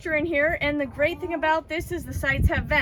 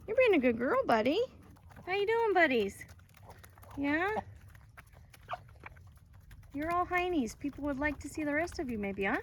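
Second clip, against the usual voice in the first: higher cooing voice to dogs; 3 semitones higher, 6 dB quieter than usual. Real, not acted. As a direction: coo at a shy puppy.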